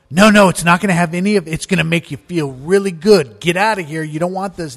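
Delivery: loud, fast, insistent; pushy stoner. Retold or imitated. Stoner pushing dope on his friend, loud and insistent. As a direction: push the drug while shooing him off. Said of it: "I mean, that make".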